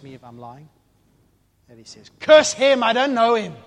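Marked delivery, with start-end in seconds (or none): 2.2-3.5 high, very loud outburst; furious cursing shout. Acted. Peter curses Jesus to deny knowing him, furious shout. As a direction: explode into a high, forceful denial.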